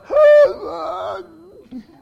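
loud, high mock gasp; exaggerated gasp of horror; shocked gasp. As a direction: gasp as if scandalized. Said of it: "and he goes".

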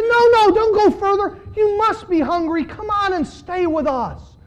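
high, loud pleading hosts; pleading disciples. Acted. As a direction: beg the guest not to leave. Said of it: "They said".

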